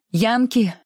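sharp high cry of alarm. Acted. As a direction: blurt it out in sudden fright. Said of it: "вскричала Скарлетт".

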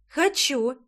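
put-on eager childish yes; a child delighted by the offer; happy little girl. A narrator's reading. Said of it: "обрадовалась малышка". (радостный детский возглас)

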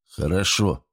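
ordinary delivery

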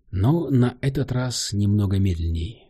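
ordinary delivery, nothing marked

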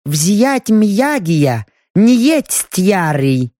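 slow, halting elf translator; broken-speech elf. Acted. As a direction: struggle with the words, slowly.

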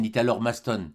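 ordinary delivery

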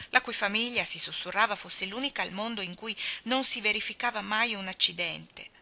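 usual speaking voice

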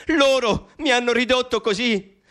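ordinary talk

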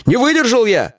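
very high-pitched, agitated self-justification; squealing complaint. A narrator's reading. Lieutenant pleads his injuries to the boss, squealing. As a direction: pile up grievances, stumbling at a squeal. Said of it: "Вдруг взвизгнул Чеченя".

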